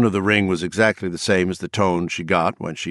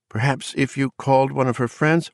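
timid mother voice; 7 semitones higher than usual. A narrator's reading.

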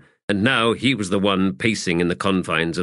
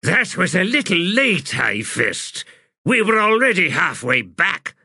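high, louder outraged complaint; 10 semitones higher, 3 dB louder than usual. Acted. Wizard scolds Paran for a late recall, outraged. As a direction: snap at the high fist for being late. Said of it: "The wizard's expression twisted with outrage".

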